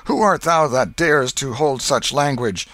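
high, loud full declamation; stagey fake archaic declaiming. A narrator's reading. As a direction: declaim the whole line grandly. Joe repeats the line.

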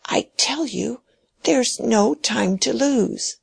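put-on an old man clinging to his will, pressing his point; stubborn dying old man. Dying old man ordering Mary to take the key, high and insistent.

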